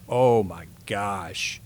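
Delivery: drawn out, slow, hushed oh my gosh; slow amazed gasp. Retold or imitated. Bowler on a hot streak, slow hushed amazement. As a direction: let the wonder stretch out slowly. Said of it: "and it's like".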